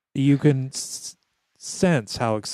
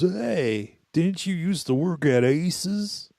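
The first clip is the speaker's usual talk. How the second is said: dude's voice; 7 semitones higher, slower than usual. Retold or imitated. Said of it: "he goes".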